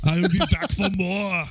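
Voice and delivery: high, slow Austrian catchphrase; hammed-up Austrian accent; mock Schwarzenegger accent. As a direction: draw it out as a cartoon Arnold. Capping the bit as Arnold.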